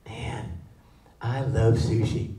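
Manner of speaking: low, slow and soft; understated fan remark. Acted. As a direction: quietly savour it, acting a sushi fan. First fan's understated opener in story.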